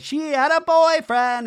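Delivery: high, loud, slow singsong jeer; sing-songy taunt. Retold or imitated. Imitating Doty in last season's clip, sing-songy. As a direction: chant it like a playground taunt. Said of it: "does a sing-songy thing".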